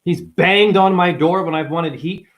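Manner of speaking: ordinary delivery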